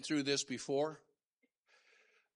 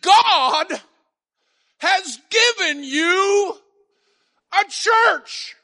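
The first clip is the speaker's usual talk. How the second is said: high, loud, slow proclaiming; bishop's proclamation; 11 semitones higher, 14 dB louder, much slower than usual. Retold or imitated. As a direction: ring out the bishop's promise. Bishop's words retold, loud climax.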